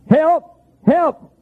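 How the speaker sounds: high, short imitated distant holler; hollered cry for help. Hunter lost at night calling out, imitated high holler. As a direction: call out high as if lost in the woods. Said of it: "I heard somebody hollering".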